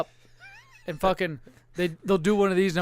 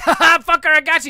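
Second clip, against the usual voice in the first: high, loud mock triumph; gloating; 9 semitones higher, 8 dB louder, faster than usual. Dad gets his child back, gleeful mock gloating. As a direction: crow it like a prankster getting revenge.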